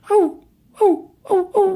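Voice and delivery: very high wailing howl; coyote howl. Acted. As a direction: howl at the empty night like a coyote. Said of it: "So he howled".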